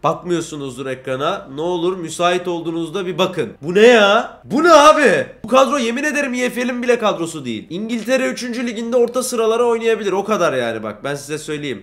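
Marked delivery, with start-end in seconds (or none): 3.6-7.0 outraged shout. Real, not acted. Outraged at the team sheet.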